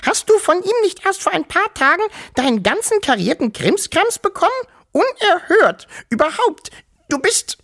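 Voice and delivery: hohe, laute, empörte Piepsstimme; empört piepsend. Acted. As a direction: quiek vor Entrüstung. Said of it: "piepste sie so laut sie konnte". (Großmutter schimpft piepsend den Onkel aus)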